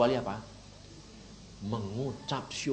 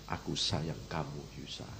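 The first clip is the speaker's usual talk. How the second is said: soft and low, fading away; tender; 9 semitones lower, 9 dB quieter than usual. Retold or imitated. Preacher voicing Jesus's tender words to him. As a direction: murmur it gently like a loving father.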